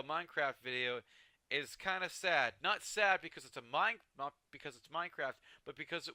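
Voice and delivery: ordinary talk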